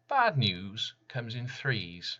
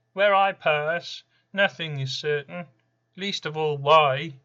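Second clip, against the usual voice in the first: Random's voice; 8 semitones higher, 11 dB louder than usual. Acted. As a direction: boom it out like a presence that haunts the room. Random speaks in his own voice.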